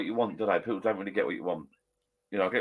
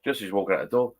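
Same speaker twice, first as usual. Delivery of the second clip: ordinary delivery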